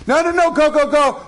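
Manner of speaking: high-pitched urging to go; imitating a wife's over-eager permission; mock insistence. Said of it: "even though she's saying to him".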